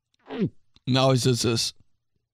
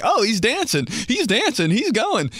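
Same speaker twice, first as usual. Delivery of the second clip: higher cheering mom; 6 semitones higher than usual. Retold or imitated. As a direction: cheer him on like an amused mom. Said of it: "She'd be like".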